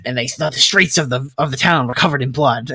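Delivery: low, quiet mock storyteller. Friend imitating a debater's history tangent, low and grave. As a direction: drop low and soft, imitating a grim history lecture. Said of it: "and he's just like".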